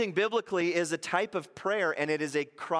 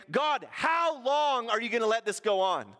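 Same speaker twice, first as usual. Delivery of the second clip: higher, louder exasperated complaint; complaining to God; 8 semitones higher, 3 dB louder than usual. Retold or imitated. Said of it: "he's complaining".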